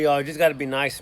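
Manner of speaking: ordinary delivery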